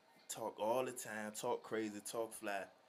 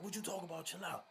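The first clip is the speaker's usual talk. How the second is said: higher mock-offended comeback; Croc's retort; 7 semitones higher, 4 dB quieter, faster than usual. Croc snaps back at the kid, higher and affronted.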